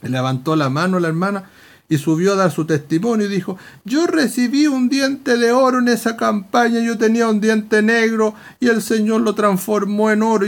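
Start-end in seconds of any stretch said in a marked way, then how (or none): 3.8-10.5 higher-pitched imitation of a testifying sister; re-enacted eager, raised-pitch witness; excited testimony. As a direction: announce a miracle eagerly to the church. Acts sister giving her testimony.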